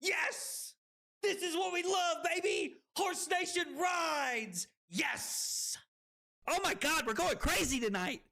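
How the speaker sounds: high, hyped-up cheering; triumphant celebration. Real, not acted. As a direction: cheer the win at full pitch. Celebrating a big game moment.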